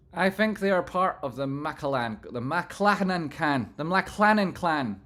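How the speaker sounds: loud read. Real, not acted. Reading a chat message aloud.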